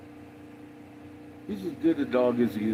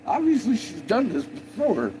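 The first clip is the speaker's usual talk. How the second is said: excited; 10 semitones higher, 3 dB louder than usual. Real, not acted. Excited retelling of dogs.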